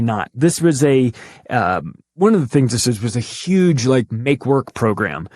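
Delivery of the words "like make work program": usual speaking voice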